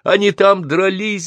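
high, stretched-out shout; yelled reply. A narrator's reading. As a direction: holler the answer back urgently. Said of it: "заорал я в ответ". (крик в ответ)

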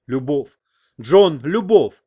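clutch her hand and declare it; ardent outcry. A narrator's reading. American passionately declares love. (страстный возглас)

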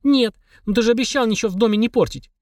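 put-on high, indignant kid voice; a child reminding of a promise. Child objects to demon.

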